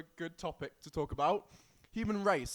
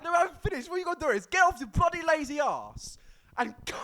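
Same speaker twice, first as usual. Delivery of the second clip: mock rant; 10 semitones higher, 6 dB louder, faster than usual. Friend insulting him, laughing.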